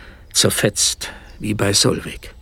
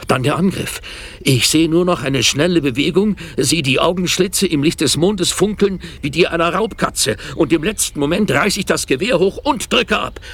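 higher and driving as the attack comes; tense, rising excitement; 7 semitones higher than usual. Acted. Man recounts the night attack to his wife, higher and tense. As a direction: tell the shot like it happens now.

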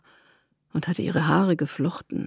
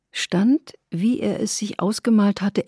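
plain reading voice

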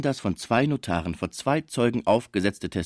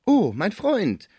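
high, loud, drawn-out greeting; kindly old musician, delighted; 10 semitones higher, 5 dB louder, much slower than usual. A narrator's reading. Musician greets the arriving father, delighted high voice.

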